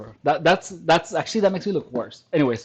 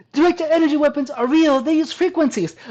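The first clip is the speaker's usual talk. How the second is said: higher, mocking singsong of the claim; hammed-up believer voice; mock conspiracy voice; 9 semitones higher than usual. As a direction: parrot the claim like a true believer.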